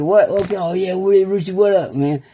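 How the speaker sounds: put-on excited little kid. Friend greeting Rooster after learning his call, excited like a little kid. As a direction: imitate a man as excited as a kid. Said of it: "he like little kid".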